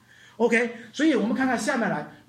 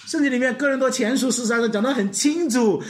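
normal talking voice

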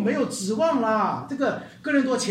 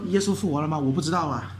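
soft, lower, unsure shrug of a voice; mock doubt; 6 semitones lower, 3 dB quieter, faster than usual. Preacher mocks a doubting believer, unsure shrug. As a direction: play the doubter who doesn't know. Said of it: "你说，".

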